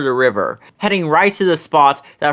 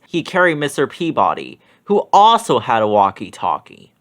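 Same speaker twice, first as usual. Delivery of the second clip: usual speaking voice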